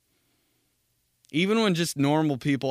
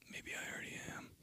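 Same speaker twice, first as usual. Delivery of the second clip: fast creepy whisper; 23 dB quieter, faster than usual. Pretend. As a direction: breathe it as a sinister aside. Dark joke aside.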